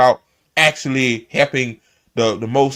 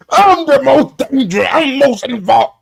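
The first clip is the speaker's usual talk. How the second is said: high, hammed-up mimicry of a self-pitying rival; mocking Jake; 8 semitones higher, 3 dB louder than usual. Mocking the guest's victim claim, in a high put-on voice. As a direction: parody his whining with a raised put-on voice.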